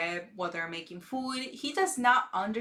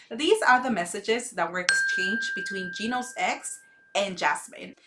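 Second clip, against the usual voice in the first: ordinary talk, nothing marked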